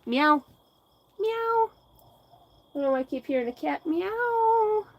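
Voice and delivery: high, playful meow; mock cat meow. Hearing a cat, mewing back at it.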